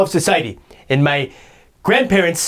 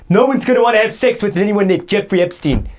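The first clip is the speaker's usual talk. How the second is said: ordinary talk, nothing marked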